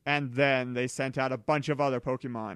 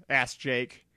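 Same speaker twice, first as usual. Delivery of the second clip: ordinary delivery, nothing marked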